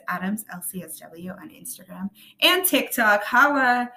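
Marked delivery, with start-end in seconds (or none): 2.4-3.9 playful shout. Real, not acted.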